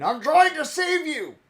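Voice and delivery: high, loud, pleading yell; hammed-up desperate cry; mock preachy shout. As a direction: parody a pushy street preacher. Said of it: "throwing your net at the fish going".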